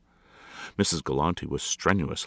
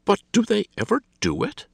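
haughty lady; 8 dB louder than usual. A narrator's reading. Lady asks.

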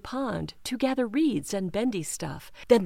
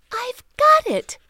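excited; 9 dB louder than usual. Acted. Sudden idea.

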